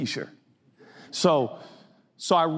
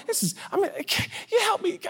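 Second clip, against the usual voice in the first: high desperate plea; 13 semitones higher, 3 dB quieter than usual. Retold or imitated. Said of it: "this is what I do with God".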